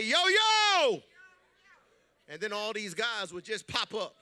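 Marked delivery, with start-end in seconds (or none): 0.0-1.0 loud high yell of Yo; gang call. Retold or imitated. Gang member calling his boys out loud. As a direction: call out to the crew loudly. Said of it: "he'd turn around and say".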